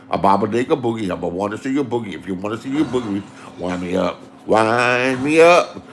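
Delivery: low, fast funky [name] jive, slowing on the last wind me up; imitating his friend's low [name] rap; [name] talk. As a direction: croon it low like a spaced-out funk star. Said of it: "That's how he talked".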